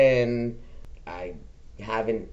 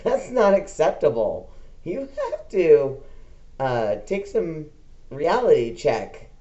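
raised, firm scolding with a laugh breaking in; half-laughing reproach, raised voice; retold scolding of dad; 6 semitones higher, 5 dB louder than usual. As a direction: tell your dad off, louder and higher, half laughing.

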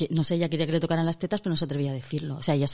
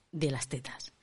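hushed, low whisper; mock-secret whisper; 4 semitones lower, 7 dB quieter than usual. Host announces the chapter on breasts, mock-secret whisper. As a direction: drop to a whisper on the naughty topic.

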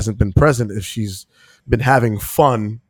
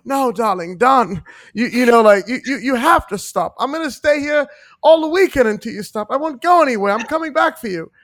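much higher, hammed-up Mid-Atlantic film star; mock Mid-Atlantic accent; 12 semitones higher, 3 dB louder than usual. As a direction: play a 1940s Mid-Atlantic heroine begging, high and theatrical. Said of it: "had that American Atlantic accent".

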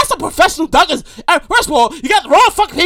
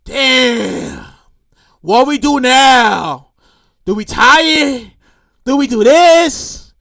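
slow, drawn-out mock bewilderment; imitating stumped judges; 4 semitones lower, much slower than usual. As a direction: drag each question out as if utterly at a loss. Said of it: "They was like".

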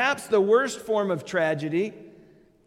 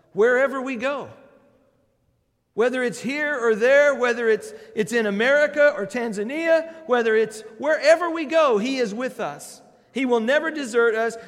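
preaching louder and higher, driving each line home; rising preaching; 6 semitones higher, 6 dB louder than usual. Acted. Pastor assuring the congregation God never leaves, preaching at full force. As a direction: build to full pulpit force.

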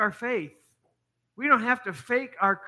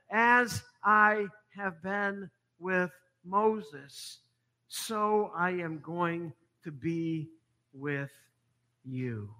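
slow, fading down to softer; slow solemn promise trailing off softer; solemn divine promise; 4 dB quieter, much slower than usual. Acted. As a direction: let God's promise sink to a hush. God's promise, slowed and fading.

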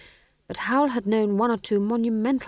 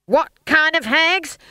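slow, high, loud question; drill sergeant demand; 9 semitones higher, 8 dB louder, slower than usual. A narrator's reading. Stage manager rousing witches, slow loud demand. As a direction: whip up the chant.

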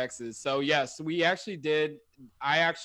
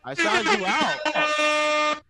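drawn out slowly, higher and louder; slow hammed-up call-out; 12 semitones higher, 7 dB louder, much slower than usual. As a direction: stretch every word, teasing him. Hammed-up calling out drunk text.

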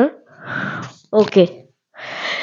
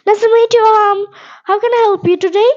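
much higher, quick reply voice; virtual partner reply; 9 semitones higher, much faster than usual. Pretend. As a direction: play the other speaker greeting you back, fast and high. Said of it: "a virtual conversation like that".

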